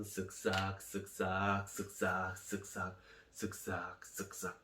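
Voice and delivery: low, soft, rhythmic chant fading away; hushed scrubbing sounds. Acted. General washing in the Jordan, hushed rhythmic scrubbing. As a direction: scrub in rhythm, dropping to near whisper.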